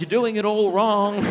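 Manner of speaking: high-pitched mock protest; exasperated heckle. Listener despairing at a friend's story, exasperated.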